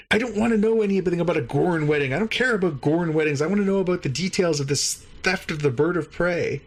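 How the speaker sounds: high-pitched impatient interrogator; mock exasperation. Alien interrogator dismissing the witness's wedding story, in high mock exasperation. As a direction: complain in hammed-up irritation.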